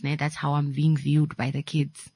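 normal talking voice

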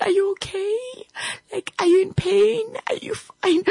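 high, tearful worried wife; anxious wife. Retold or imitated. Wife asking her husband if he is in pain, high and tearful. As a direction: fret over him near tears.

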